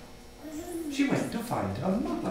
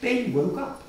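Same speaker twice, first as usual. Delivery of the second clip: ordinary delivery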